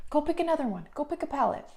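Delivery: a touch of high pet talk; dog voice. Real, not acted. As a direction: lightly coax the dog, sing-song. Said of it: "I do use my dog voice in this video".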